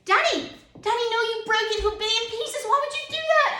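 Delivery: high, loud, dismayed protest; exasperated outcry. Acted. Parent protesting over a broken pottery piece, exasperated. Parent amid children's mishap, acted.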